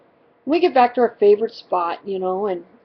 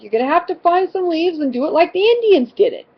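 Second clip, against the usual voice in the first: put-on high, brisk retort to a child; imitating a fed-up parent laying down the plan; retold exasperation, quick and pitched up; exasperated instructions; 7 semitones higher, faster than usual. Mother answering the complaint, retold.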